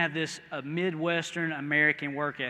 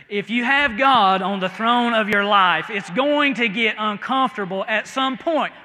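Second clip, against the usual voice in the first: louder, higher, preaching hard; preaching at full force; 4 semitones higher, 9 dB louder than usual. Shown as a demonstration. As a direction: belt it out to the back row. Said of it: "let me try over here".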